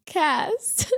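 words repeating and fading; echo effect. Pretend. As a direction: do a mock echo. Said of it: "That was my echo effect".

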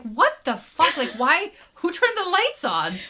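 retold bewilderment. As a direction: demand an answer in mock disbelief. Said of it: "'cause I was like".

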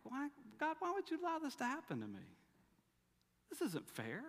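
a hammed-up whimper of why me; whiny complaint to God. Believer whining to God about unfair hardship, mock whiny.

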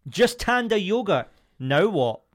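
loud, high, slow squaring-up voice; tough guy challenge. Acted. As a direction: square up to someone, loudly. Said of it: "that was a great impression".

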